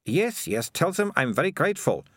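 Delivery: high, brushing off the reproach; dismissive high character voice; Melissa, dismissive girl. A narrator's reading. Melissa to Keith about thanking the rats, grudging thanks.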